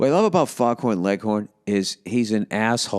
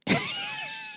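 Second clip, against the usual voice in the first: high squealing laugh; 7 dB quieter than usual. Real, not acted. Laughing at the cartoon.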